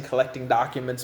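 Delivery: usual speaking voice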